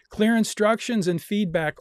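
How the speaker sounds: loud and high reading of all caps; all caps shout. Acted. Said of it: "all caps and tons of exclamation marks".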